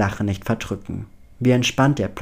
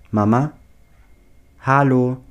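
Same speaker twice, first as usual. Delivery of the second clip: louder, questioning call down a bad line; raised-voice phone check; 2 semitones higher, 5 dB louder than usual. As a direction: check if anyone is still on the line. Phone connection drops, calls for mother.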